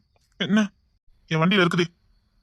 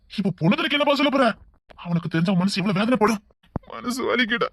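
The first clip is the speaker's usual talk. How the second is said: ordinary talk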